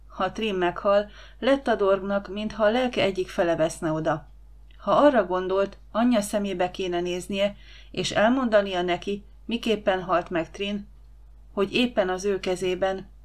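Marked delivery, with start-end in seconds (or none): none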